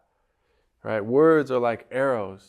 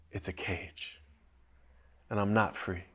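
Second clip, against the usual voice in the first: whisper; 4 semitones lower, 10 dB quieter than usual. Retold or imitated. Parrot's confession, dropping voice.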